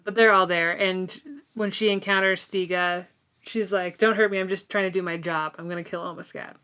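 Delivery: ordinary delivery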